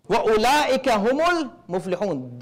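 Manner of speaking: rapid chanted Arabic verse; brisk Quranic recitation. Acted. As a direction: recite the verse quickly in tajweed style.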